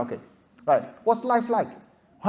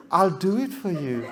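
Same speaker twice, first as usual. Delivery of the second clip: sweet-talking suitor; 2 semitones lower, 5 dB quieter than usual. Retold or imitated. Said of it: "he goes".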